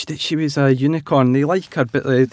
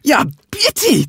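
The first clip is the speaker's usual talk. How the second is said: high, slow delighted cheer; 4 dB louder, slower than usual. A narrator's reading. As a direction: cheer it like excited kids. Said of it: "cheered Peppa and Susie".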